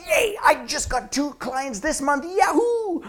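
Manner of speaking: high, slow, whooping fake celebration; hammed-up shout of joy; outside voice. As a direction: cheer it loud and overexcited. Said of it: "Or the outside voice says".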